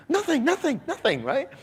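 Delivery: high falsetto denial, imitating the wife; flustered wife. Wife hiding purchases from husband, flustered falsetto.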